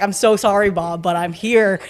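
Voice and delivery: usual speaking voice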